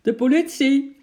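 high, loud call; shouted announcement. A narrator's reading. Police sergeant shouts up to the man, loud. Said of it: "riep de brigadier".

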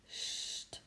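unvoiced soft hush. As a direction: hush him so the child sleeps. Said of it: "zei vader".

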